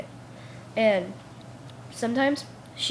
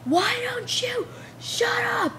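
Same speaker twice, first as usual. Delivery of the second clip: high, drawn-out mock teacher shout; yelled outburst; 13 semitones higher, slower than usual. Teacher snapping at a student who spoke, yelling. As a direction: bellow it slowly in a high, put-on yell. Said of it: "you're still yelling a tantrum".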